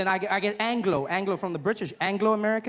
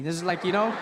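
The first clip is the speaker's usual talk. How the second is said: normal talking voice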